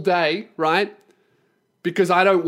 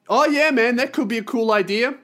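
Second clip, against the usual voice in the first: raised pitch, put-on agreeableness; feigned enthusiasm; 6 semitones higher than usual. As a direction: play along as if keen. Feigned agreement to Kiwi's idea.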